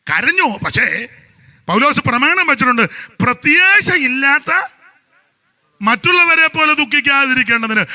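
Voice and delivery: loud, high, fervent sermon delivery; full-force preaching. Acted. Preacher thundering to the congregation with fervent loud preaching. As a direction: preach it out to the whole church.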